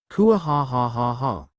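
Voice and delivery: slow, hammed-up evil cackle; a drawn-out gloating mock-villain laugh; dragon's smug triumphant laugh; villainous laugh.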